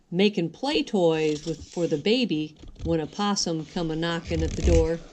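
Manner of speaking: ordinary delivery, nothing marked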